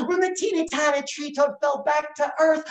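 ordinary delivery, nothing marked

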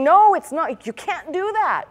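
high, loud, anxious protest; pleading disciple. Retold or imitated. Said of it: "the disciple getting a little bit testy and saying".

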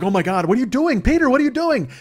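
put-on high, loud panicked girls; shocked girls. Girls shrieking at their drunk friend, high and loud. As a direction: shriek it like frightened young women.

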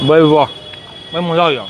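chewing while talking; talking mid-bite; mouth full of food. Real, not acted. Seen 0: took a bite at -3; mouth still working, food in hand.

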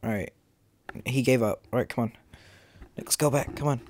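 ordinary delivery

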